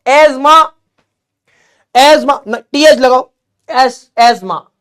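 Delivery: "एस्मा" with the s buzzed like "ezma"; silent th. Shown as a demonstration. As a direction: say "एस्मा" as "ez-ma", th swallowed. ("एस्मा" को "एज़्मा" जैसा बोलो)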